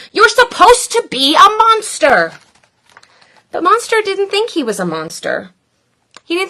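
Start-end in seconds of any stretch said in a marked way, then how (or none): 0.1-2.3 high loud scolding. Acted. The group scolds the gentle monster, high. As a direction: tell him off.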